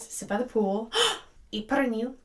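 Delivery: normal talking voice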